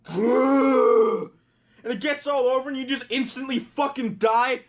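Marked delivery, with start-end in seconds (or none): 0.1-1.3 loud gross grunt; disgusted frog grunt. Retold or imitated. Cursed frog breathing out, loud grunt. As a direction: spit out a gross croak. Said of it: "They're like".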